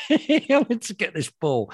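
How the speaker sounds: laughing while talking. Real, not acted. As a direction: struggle to get it out through laughs. Laughing at own story.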